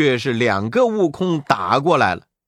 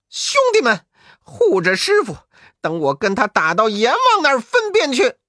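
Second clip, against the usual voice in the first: commanding monkey; 8 semitones higher, 3 dB louder than usual. Acted. Wukong orders brothers mid-fight.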